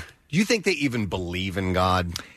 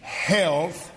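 preacher voice; 8 semitones higher, slower than usual. Retold or imitated. Reading scripture in preacher style.

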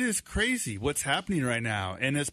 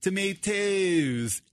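put-on slow, higher British-accented "tomatoes"; 5 semitones higher, much slower than usual. Dad imitating his son's words, slow British accent. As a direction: draw out a child's British "tomatoes". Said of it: "I can hear him saying".

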